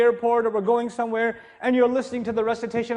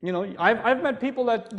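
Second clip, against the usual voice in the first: normal talking voice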